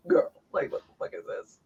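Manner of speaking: dropping to an unvoiced disbelieving hush; stunned disbelief. Retelling her stunned reply to the crying rookie, hushed disbelief. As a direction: let the disbelief fall to a breath. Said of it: "And I was like".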